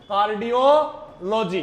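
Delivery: Cardiology drawn out slowly in parts; slow dictation. Shown as a demonstration. Teacher has students write the term down, slow dictation. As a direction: spell out cardiology slowly by sound for note-takers.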